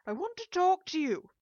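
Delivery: high, loud demand; insistent shout. A narrator's reading. Mother wants into daughter's room, shouting insistently. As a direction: shout insistently through the door.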